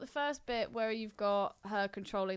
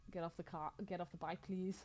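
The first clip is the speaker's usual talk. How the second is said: polite request; 3 semitones lower, 11 dB quieter than usual. Retold or imitated. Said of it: "really polite to him".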